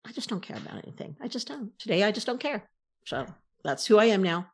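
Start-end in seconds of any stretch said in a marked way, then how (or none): 0.1-2.7 much softer, listless quoted reply; not caring. Retold or imitated. Answering her worried husband, much softer and indifferent. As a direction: let it go flat out, drained of interest. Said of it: "I said".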